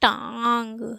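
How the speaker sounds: टांग with long nasal आ, trailing ग; nasalised vowel demo. Shown as a demonstration. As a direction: stretch टांग through mouth and nose. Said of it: "आंग निकल रहा है ना".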